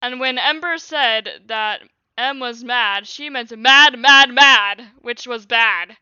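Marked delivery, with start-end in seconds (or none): 3.6-4.9 louder each mad, hammering the word; shouted emphasis. Acted. Reader yelling mad, mad, mad to stress it.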